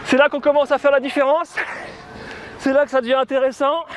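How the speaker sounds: ordinary talk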